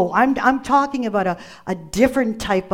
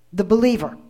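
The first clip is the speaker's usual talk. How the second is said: ordinary delivery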